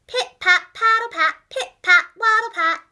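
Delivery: march the words like waddling ducks; duck footsteps chant. A narrator's reading. Narrator imitating the ducks' marching feet, loud and bouncy.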